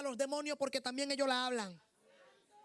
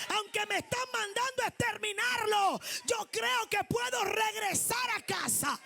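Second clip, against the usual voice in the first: loud, higher shouted preaching, slowing on lines; full-force preaching; 6 semitones higher, 6 dB louder than usual. Acted. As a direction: cry out Abraham's faith to the church. Preacher voices Abraham shouting.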